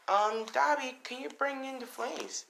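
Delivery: high, lilting request; villain girl. Acted. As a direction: call a partner in a high girlish voice. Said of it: "She's like".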